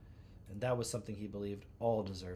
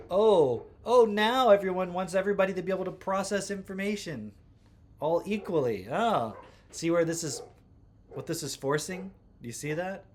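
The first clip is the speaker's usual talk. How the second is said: sarcastic outburst; 7 semitones higher, 8 dB louder than usual. Acted. Mocking outburst at critics.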